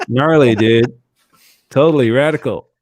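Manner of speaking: loud, put-on American surfer dude; American accent. Shown as a demonstration. Brit showing off his American accent, loud surfer-style American. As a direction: ham up a Californian dude. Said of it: "that's my American accent".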